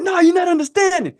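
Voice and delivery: imitated high-pitched holler. Teammate insisting he's not understanding, hollering. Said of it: "Stop hollering".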